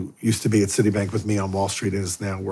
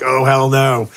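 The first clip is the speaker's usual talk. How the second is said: alarmed refusal; 4 semitones higher, 6 dB louder, slower than usual. Retold or imitated. Facing foaming possessed woman.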